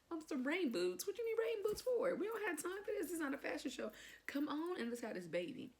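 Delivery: high, loud mock outrage settling toward the end; incredulous exasperation. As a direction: scold the doctor in comic disbelief. Acting out impatient outburst at doctor's boots.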